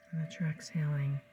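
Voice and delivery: calm, soothing hypnotic guidance; hypnotist. Acted. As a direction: lull the listener into trance. Leads a hypnosis session over music.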